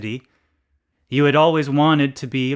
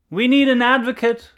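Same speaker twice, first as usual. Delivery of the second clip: a high, urgent cry of "Advocacy"; 10 semitones higher, 6 dB louder than usual. A narrator's reading. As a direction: call out like rallying a crowd. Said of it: "Persons cried".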